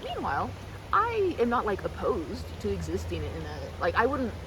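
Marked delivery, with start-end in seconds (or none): none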